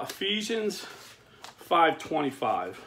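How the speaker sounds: normal talking voice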